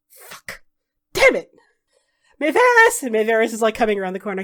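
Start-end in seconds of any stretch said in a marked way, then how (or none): 0.1-0.6 unvoiced under-breath curse; whispered curse. 1.1-3.0 loud high angry call; shouting. Acted. Dorian shouts for partner.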